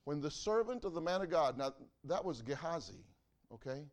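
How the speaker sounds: loud, ringing pulpit preaching that climbs as the story builds; preaching at full force. Acted. Preacher telling his church the Elisha story, loud and full. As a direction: preach it out big to the congregation.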